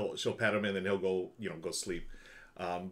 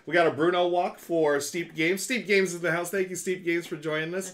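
excited; 7 semitones higher, 6 dB louder than usual. Real, not acted. Hype train arrives.

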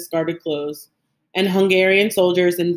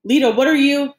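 higher, louder startled question; alarmed question; 7 semitones higher, 5 dB louder than usual. A narrator's reading. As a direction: break in with sudden alarm. Alarmed as grandfather starts to leave.

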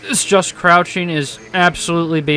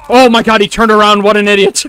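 loud, high-pitched gleeful amazement; raised voice, thrilled and incredulous; excited disbelief; 5 semitones higher, 8 dB louder than usual. Real, not acted. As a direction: exclaim at a stroke of luck. Player reacts to lucky turn.

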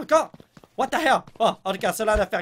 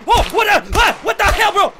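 much higher, much louder panicked yell; startled scream; 13 semitones higher, 11 dB louder than usual. Real, not acted. Gamer startled by a sudden attacker, screaming into the mic. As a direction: scream as something jumps out at you. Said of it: "désolé d'avoir crié dans vos oreilles".

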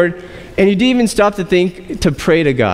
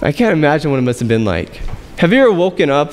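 ordinary delivery

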